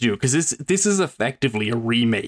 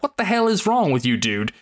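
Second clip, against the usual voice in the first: exasperated outburst; 8 semitones higher than usual. Real, not acted. Exasperated rant about boyfriend.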